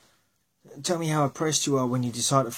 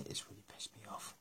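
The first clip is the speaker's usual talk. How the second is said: sudden whispered aside; 3 semitones lower, 23 dB quieter, faster than usual. Real, not acted. A vlogger complaining about the road closures, whispering. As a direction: drop to a bitter whisper.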